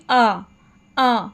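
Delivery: "आ" as a separate long open vowel; vowel demo. Shown as a demonstration. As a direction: give "आ" alone, clearly held. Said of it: "देखिए, sound क्या थी". ("आ" को अलग से, लंबा बोलें)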